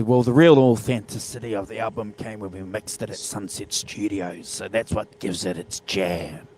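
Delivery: hint of a laid-back band member talking shop. Host imitating the Stones explaining their sound, low and soft. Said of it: "the Rolling Stones might say".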